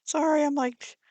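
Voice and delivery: high, giddy apology after a laugh; gushing excitedly. Real, not acted. To viewers over pretty paper, giddy and excited. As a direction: breaks off, thrilled by the paper. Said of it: "drooling over this".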